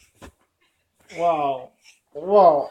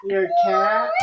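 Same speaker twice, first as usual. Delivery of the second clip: mock surprise. Dad asks the child playfully.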